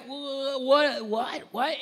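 imitating stunned disbelief; dumbfounded stammer. Recalling his eyes bugging out, dumbfounded stammer. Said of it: "I'm just like".

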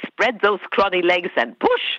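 a taste of the character's menacing line; quoting her character. Retold or imitated. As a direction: deliver it like the harsh nurse she plays. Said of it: "the last one's like".

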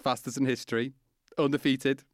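lifted, punchy podcast intro read; announcer intro. Acted. Host introducing the guest in an announcer read. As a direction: sell the champion to the listeners.

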